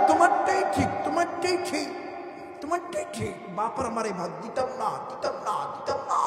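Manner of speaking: cornered henpecked husband. Acted. Husband tells his wife she is right, mock helpless surrender. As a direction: cave in as the hapless husband. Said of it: "ডাক দিয়া কই".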